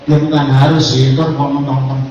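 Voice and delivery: ordinary delivery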